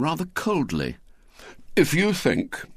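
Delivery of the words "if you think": higher, measured stern opening. A narrator's reading. William rebuking the little girl, stern. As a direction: start a stern telling-off. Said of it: "said William sternly".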